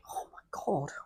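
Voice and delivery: real emotional whisper; overcome with emotion. Real, not acted. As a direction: voice drops away to a whisper. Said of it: "I'm really feeling emotional".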